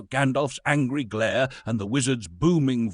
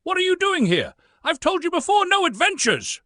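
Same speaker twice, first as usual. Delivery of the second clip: high, louder hobbit voice full of dread and annoyance; a small hobbit squeaking out his dread and irritation; high-pitched, louder plea of an annoyed, fearful hobbit; 5 dB louder than usual. A narrator's reading. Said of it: "a mixture of dread and annoyance creeping into his voice".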